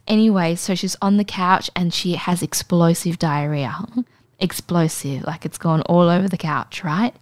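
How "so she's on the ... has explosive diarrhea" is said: ordinary talk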